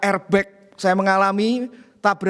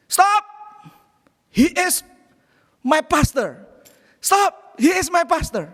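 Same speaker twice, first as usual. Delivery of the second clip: calling out stop; 7 semitones higher, slower than usual. Retold or imitated. Young men shouting at the angry driver to protect their pastor, high urgent yell. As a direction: yell out to halt him, pitched high.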